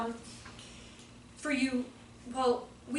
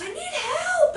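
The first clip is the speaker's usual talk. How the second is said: put-on high, loud little-kid plea, laughing; mock kid crying for help; imitating little boy; 14 semitones higher, 4 dB louder, much slower than usual. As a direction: squeak it out like a small boy begging, laughing. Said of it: "he goes".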